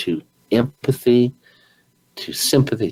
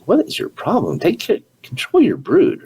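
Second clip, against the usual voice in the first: exasperated onlookers; 12 semitones higher than usual. Retold or imitated. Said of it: "everybody's looking at this guy like".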